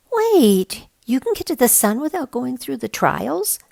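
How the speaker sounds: high, loud disbelieving burst; can't believe what he's hearing; incredulous. A narrator's reading. Boy Mac doubts Spider-Woman about the trials, incredulous. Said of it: "Mac asks incredulously".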